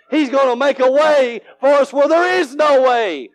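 high, emphatic promise; full-force preaching. Real, not acted. Preacher assuring the church God makes a way, full-force preaching. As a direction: lift it up and declare it.

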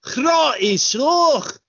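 Scottish Trainspotting-style rant; Scottish accent. Retold or imitated. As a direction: do a hammed-up Scottish tough guy. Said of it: "remember him in Trainspotting".

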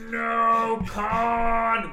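high, loud anguished cry; Spock yelling Khan. Retold or imitated. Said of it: "You have Spock saying".

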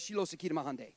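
rapid run-together mumbo-jumbo; quick slur. Shown as a demonstration. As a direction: slur it quickly like fake speaking in tongues. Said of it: "If you slur it quick, it does".

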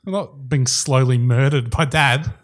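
usual speaking voice

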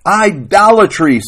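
loud, high acted scream; screaming wife's accusation. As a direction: cry it out in outrage. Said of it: "Sarah Ruth screamed".

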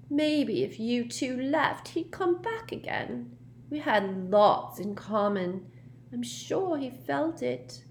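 put-on high, slow, wistful girl's voice; plaintive ghost girl. A narrator's reading. Myrtle about her absent friend, plaintive. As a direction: moon over him, drawn out.

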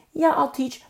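high, loud, too-ready agreement; mock willing teacher. Paid teacher agreeing before naming a price, mock willing. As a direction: imitate an eager yes before the catch. Mimics a teacher demanding money.

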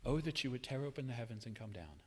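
soft, quick, lifeless read; the wrong way to read Isaiah; flat reading. Shown as a demonstration. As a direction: toss it off quietly, no feeling. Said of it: "Never read these verses as".

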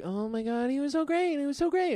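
high-pitched mock gushing. Mocking people gushing over actors.

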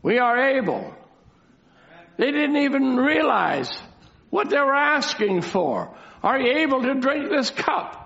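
high-pitched, declamatory sermon voice; emphatic preaching. Real, not acted. Preacher retelling the disciples asked to drink the cup, emphatic preaching. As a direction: proclaim it to the whole congregation.

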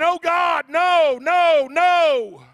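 high, loud desperate screams of no; terrified screaming. Retold or imitated. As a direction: scream for your life as they drag you. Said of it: "I'm screaming".

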